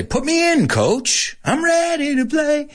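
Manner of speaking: high, eager plea to be sent in; eager to a coach. Shown as a demonstration. Player asking his coach to play, high and eager. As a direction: beg the coach to let you play. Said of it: "See how the whole intention in my voice changes".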